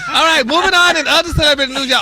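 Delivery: laughing high and loud into the next story; laughing shout. Real, not acted. Host laughing at co-host's joke, loud laughing shout. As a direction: shout it through a big laugh.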